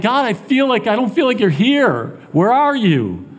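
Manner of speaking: high-pitched, pleading complaint to God; an honest, distressed cry of abandonment. Acted. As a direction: plead upward, hurt and questioning. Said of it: "we can express that".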